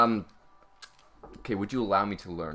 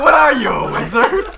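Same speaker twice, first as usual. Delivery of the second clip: laughing repeat; 16 dB louder than usual. Real, not acted.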